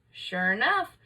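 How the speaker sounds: ordinary delivery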